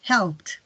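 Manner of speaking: "helped" in one syllable with a released t; strong final T. Shown as a demonstration. Teacher contrasts help and helped, "helped" with strong T. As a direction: make the T ending "helped" stand out. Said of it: "la terminación debe ser más fuerte".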